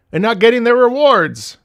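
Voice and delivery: tag exasperated. Real, not acted. Upset viewers lose rewards.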